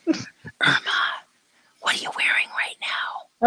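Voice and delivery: creepy breathy whisper. Acted. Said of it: "Okay, that's creepy".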